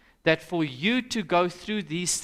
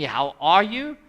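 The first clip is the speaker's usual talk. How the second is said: "How are you?" said louder with an incredulous rise on "are"; indignant echo; 6 dB louder than usual. Retold or imitated. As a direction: throw the question back, offended. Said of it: "and you're asking me".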